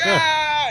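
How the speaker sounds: high, hammed-up yell of charging through; doctor yelling as he races past the pines; mock yell. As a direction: yell it like a cartoon hero charging.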